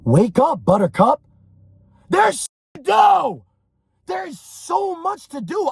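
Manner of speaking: loud, rising to a high drawn-out yell; wake-up shout. Acted. Coach to someone going to sleep, shouting. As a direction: yell them out of bed. Yelling at imagined sleeper to get up.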